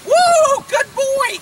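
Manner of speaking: high, loud whoop of praise; excited praise. Pretend. Rewarding the horse for Spanish, excited high praise.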